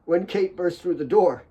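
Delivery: ordinary delivery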